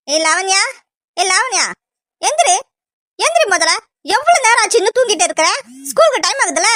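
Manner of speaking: high-pitched nagging mother; exasperated morning scolding; scolding wake-up. Acted. Mother scolding sleeping child awake.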